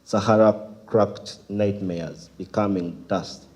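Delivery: deliberate spoken-word reading; emphatic starts, falling line ends; poem recitation. Acted. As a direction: recite phrase by phrase with pauses.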